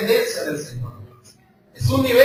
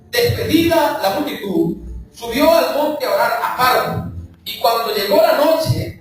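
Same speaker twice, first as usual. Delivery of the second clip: raised, declamatory Bible reading; scripture reading; 4 semitones higher than usual. Acted. Preacher reading Matthew aloud to the church, declaiming. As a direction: proclaim the passage to the congregation.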